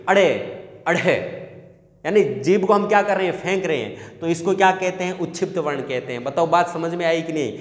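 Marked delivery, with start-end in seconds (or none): 0.1-1.4 अड़े with the tongue bouncing off the palate; tossed-tongue flap. Shown as a demonstration. Teacher shows the tongue jumping, flapped अड़े. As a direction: flick each अड़े out separately. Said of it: "उछलती है".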